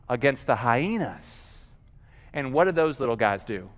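normal talking voice

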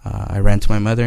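normal talking voice

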